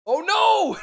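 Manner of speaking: cartoonish yelp of a beaten defender; mock dismay. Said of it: "He was just like".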